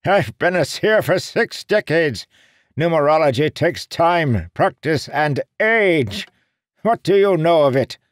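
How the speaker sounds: high, loud offended seer; indignant old man. Acted. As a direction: bristle with wounded pride.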